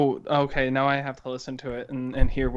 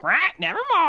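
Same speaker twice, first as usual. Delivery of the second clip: high squawky nevermore; mock parrot squawk; 14 semitones higher than usual. Co-host mocks the raven as a high parrot squawk. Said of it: "I was almost anticipating you being like".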